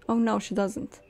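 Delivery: usual speaking voice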